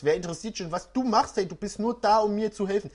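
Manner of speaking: ordinary talk, nothing marked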